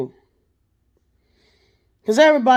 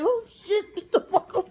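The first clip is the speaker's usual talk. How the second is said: panicked laugh; 11 semitones higher, 6 dB quieter than usual. Retold or imitated. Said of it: "I'm like".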